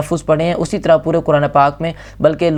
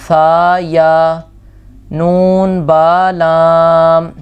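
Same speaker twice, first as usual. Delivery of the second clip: slow recitation; much slower than usual. Shown as a demonstration. Teacher drills letter names.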